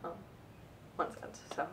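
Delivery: a little choked up. Real, not acted. Vlogger filming a last video before the show ends, choked up. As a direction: let the voice sink and fade out, choked up. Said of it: "getting a little choked up".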